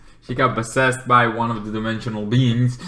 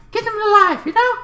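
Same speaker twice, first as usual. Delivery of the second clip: much higher, squeaky plea; hammed-up high voice; mock high urging; faster than usual. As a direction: pitch it way up like a cartoon.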